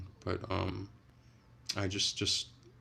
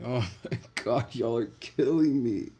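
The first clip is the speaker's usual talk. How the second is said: laughing at chat; 4 semitones higher, 6 dB louder than usual. Real, not acted. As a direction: crack up at the chat and say it through the laugh. Reacting to funny chat messages.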